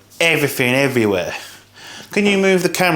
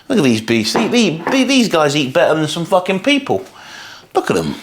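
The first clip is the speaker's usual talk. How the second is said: normal talking voice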